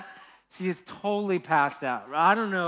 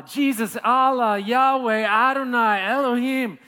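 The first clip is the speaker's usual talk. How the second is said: loud, much higher mock scream of God's names; 7 semitones higher, 9 dB louder than usual. The whole plane crying out to God in turbulence, loud high mock scream. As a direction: cry out each name like a terrified passenger. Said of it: "is screaming".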